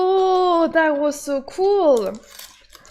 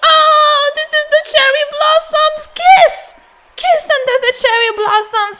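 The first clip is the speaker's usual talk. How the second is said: excited squeal; 10 semitones higher, 6 dB louder than usual. Real, not acted. Fangirling over cherry blossom scene.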